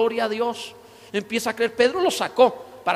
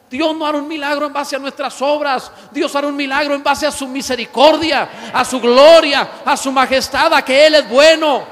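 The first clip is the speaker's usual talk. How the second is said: loud, fervent preaching to the congregation; loud sermon shout; full-force preaching; 4 semitones higher, 11 dB louder than usual. Acted. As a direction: proclaim it loud like a revival preacher. Preaching climax on God's goodness.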